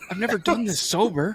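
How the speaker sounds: high-pitched, joking disbelief; mock dismay. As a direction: join in the joke as the appalled patient. Co-host joins in the mock dismay.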